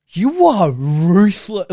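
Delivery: stunned disbelief. Retold or imitated. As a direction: stretch it out, floored by the harshness. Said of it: "and I'm like".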